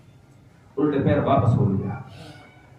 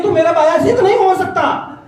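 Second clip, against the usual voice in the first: high, loud firm refusal; stern mother; 7 dB louder than usual. Retold or imitated. Son retelling his mother's refusal, high and loud. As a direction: declare it with force. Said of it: "وہ کہتی ہے".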